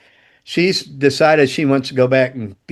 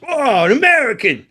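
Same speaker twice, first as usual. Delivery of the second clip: a much higher Australian kid voice, mock surprise; imitating Aussie kid; 9 semitones higher than usual. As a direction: mimic the Australian kid spotting an American, pitched up. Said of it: "like this".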